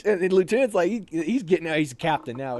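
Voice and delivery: ordinary delivery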